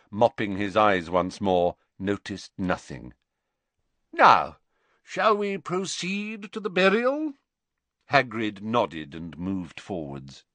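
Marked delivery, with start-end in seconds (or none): 4.1-7.3 lightly voiced pompous professor, higher; a hint of theatrical sorrow; Slughorn voice. A narrator's reading. Slughorn to Hagrid on the sad night, hammed-up.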